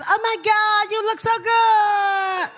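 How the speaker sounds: high, drawn-out fake Hollywood gushing; held-breath bougie talk. Mocking fake Hollywood people greeting you, held-breath bougie voice. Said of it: "people who hold their breath and talk".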